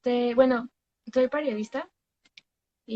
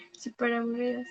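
whispered through a laugh; giggling whisper; 7 dB quieter than usual. Real, not acted. Laughing at silent viewers.

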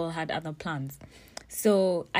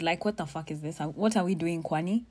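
ordinary delivery

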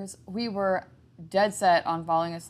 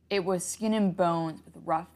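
usual speaking voice

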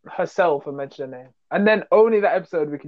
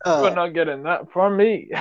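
usual speaking voice

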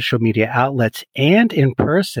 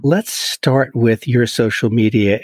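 ordinary delivery

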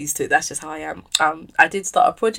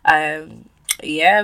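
ordinary talk, nothing marked

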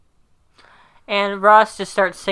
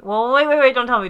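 higher, louder flustered protest; anxious stop-it plea; mock flustered; 5 semitones higher, 5 dB louder, faster than usual. [name] left out, anxious.

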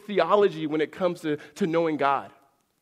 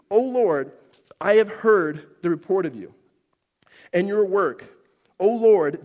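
raised, solemn reading of a prayer; preacher reading the prayer aloud; scripture reading; 3 semitones higher, 4 dB louder than usual. Acted. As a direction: proclaim the prayer over the congregation. Reads Habakkuk's prayer aloud.

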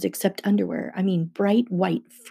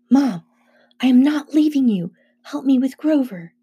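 higher-pitched, insistent pleading; urgent insistence; 6 semitones higher, 3 dB louder than usual. A narrator's reading. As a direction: plead firmly with your mother. Boy insists on not leaving mother.

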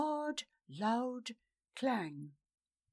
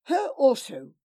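much louder commanding; louder curt command; loud order; 4 semitones higher, 11 dB louder than usual. Acted. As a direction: order it loudly. Lord ordering guards.

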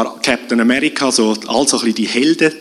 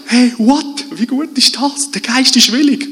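viel höher, staunend und freudig; begeistert; 11 semitones higher than usual. Acted. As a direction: als ob man gerade etwas Wunderbares entdeckt. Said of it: "Und das erneuerte Denken, oder? Sagt". (begeistert und viel höher, staunend)

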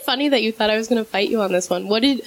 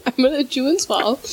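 laughing; 3 semitones higher than usual. Real, not acted. Laughing with mouth full.

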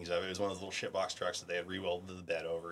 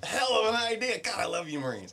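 high, sarcastic admiration; mock praise; 6 dB louder than usual. Praising the Marines' bad idea in mock awe. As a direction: gush it with obvious irony.

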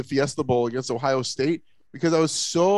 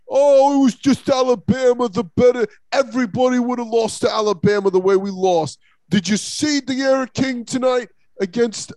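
mocking Canes fans; 6 semitones higher, 4 dB louder than usual. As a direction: gloat like a cocky fan after a cheap win. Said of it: "I'm making Canes fans do all this".

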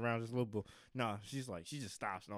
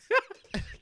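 very high loud squeal of laughter; 13 dB louder than usual. Real, not acted. Shrieking laugh.

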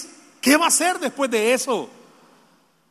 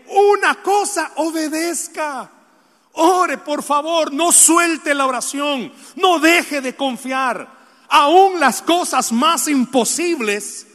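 slow pleading shout; 6 semitones higher, slower than usual. Real, not acted. Preacher pleads with congregation.

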